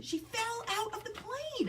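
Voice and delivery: high and slow, each word of She fell out of the plane stretched; child voice, drawn out. Retold or imitated. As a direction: drag it out like a sobbing little girl. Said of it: "And she's like".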